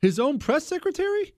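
can't believe what he just heard; genuine surprise. Real, not acted. Host stunned that the press secretary lacks access, surprised.

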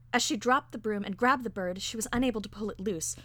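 plain reading voice

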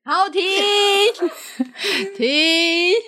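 high, laughing plea to stop. Real, not acted. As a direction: cut her off while laughing. Laughing cutting child off.

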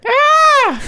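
high, loud shower-scene shriek; horror movie scream. Pretend. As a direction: scream like the lady in a horror film. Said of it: "it was the lady again".

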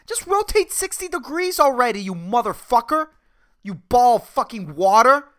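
high, loud ranting at the planet; mock rant. Host cursing out the earth, hammed-up fury.

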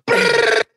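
high, loud rattling burst; imitated machine-gun fire. Storyteller mimicking the retaliation shots, rapid-fire burst. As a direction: rattle off mock gunfire with the mouth.

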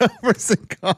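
high, laughing repeat of a chant; words squeezed out through laughter; laughing echo. Real, not acted. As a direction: crack up while repeating the silly chant. Cracking up over retold chant.

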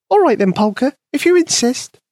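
high, tiny mouse voice; squeaky mouse voice. A narrator's reading. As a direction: voice a small eager mouse. Mouse Penny speaks.

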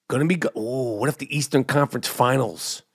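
ordinary delivery

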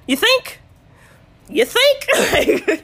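high, loud, incredulous jab breaking into laughter; sarcastic retort. Retold or imitated. Laboring woman answering mother-in-law's remark, loud mock disbelief. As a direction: throw it back with hammed-up sarcasm.

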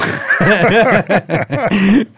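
big high burst of laughter; loud high laugh. Real, not acted. Recruit laughing at his own impression, loud and high.

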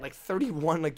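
ordinary delivery

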